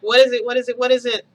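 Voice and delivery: loud, urgent repeated question; alarmed shouting. Retold or imitated. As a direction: bark it out, panicked. Walks in on screaming kids.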